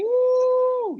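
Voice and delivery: high loud whoop of joy; excited whoop. Real, not acted. Singer cheers when host names his hit. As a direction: whoop with delight. Cheers at [name] mention.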